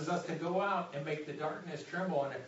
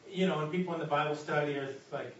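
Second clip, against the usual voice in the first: ordinary delivery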